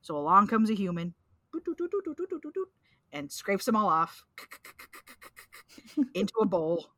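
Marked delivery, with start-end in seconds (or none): 1.5-2.7 high sing-song doot doots; doot doot walking hum. Acted. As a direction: hum a strolling tune. Humming walking sound effect.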